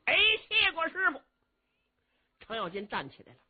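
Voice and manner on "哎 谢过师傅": eager thanks. Acted. Cheng thanks master.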